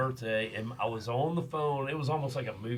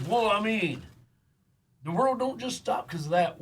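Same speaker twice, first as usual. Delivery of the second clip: higher, louder dismissive dad voice; imitating dad; 9 semitones higher, 5 dB louder than usual. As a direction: mimic dad brushing it off. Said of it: "And he goes".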